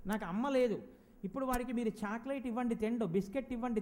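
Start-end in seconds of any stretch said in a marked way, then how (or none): 0.1-0.8 put-on lost child's lament. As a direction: imitate a motherless child's plaintive lament. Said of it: "ఇప్పుడు వాడు". (తల్లి లేని పిల్లాడి దీనమైన ఏడుపు గొంతు అనుకరణ)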